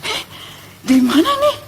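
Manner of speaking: high startled question; kaget. Retold or imitated. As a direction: act out waking up bewildered, voice high. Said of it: "saya langsung kaget". (bertanya kaget, nada tinggi)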